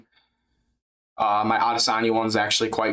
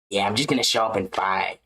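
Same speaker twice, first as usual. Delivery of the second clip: lower, lazy fighter voice; JDM impression; 6 semitones lower than usual. Doing a JDM impression for chat, lower voice. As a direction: shrug it off like JDM. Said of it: "J D M impression".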